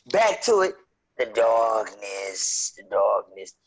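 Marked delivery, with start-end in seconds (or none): 1.2-2.7 slow drawn-out ominous line. Acted. Restarting dramatic horror intro. 2.8-3.5 lower, darker second take; deep ominous voice. Acted. Repeating in deeper voice.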